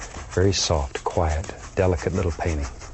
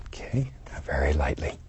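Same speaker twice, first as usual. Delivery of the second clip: whispering; 3 semitones lower, 7 dB quieter than usual. Real, not acted. Delicate brush step, hushed.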